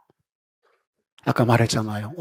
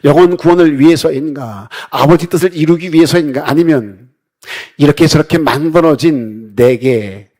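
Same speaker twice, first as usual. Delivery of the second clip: loud, rising rhetorical questions from the pulpit; forceful preaching; 3 semitones higher, 7 dB louder than usual. Acted. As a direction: preach it out to the congregation. (힘 있게 설교하듯)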